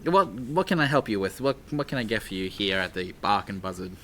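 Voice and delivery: ordinary talk, nothing marked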